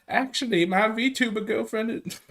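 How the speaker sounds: high, slow put-on voice; Ralph. Host playing a debater bragging about a girlfriend, in a high mock voice. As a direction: impersonate him, pitched well up and dragged out. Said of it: "I'm doing the Ralph voice".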